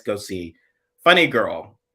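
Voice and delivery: normal talking voice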